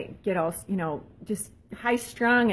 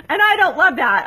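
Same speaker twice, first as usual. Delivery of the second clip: laughing; 8 semitones higher, 11 dB louder than usual. Real, not acted. Laughing about her cluttered van.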